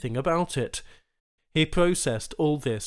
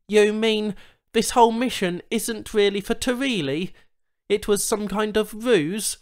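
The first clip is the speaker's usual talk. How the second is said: bewildered; 9 semitones higher, 4 dB louder than usual. Acted. Charl confused questions.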